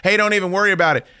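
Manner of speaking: high, cocky mock expert, fast at the end; mock know-it-all. Friends at the snake, mocked high and cocky. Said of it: "everyone's a snake expert".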